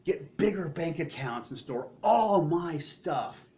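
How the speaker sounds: lightly voiced greedy man; greedy rich man. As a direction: voice the man hoarding for himself. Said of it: "the man is saying".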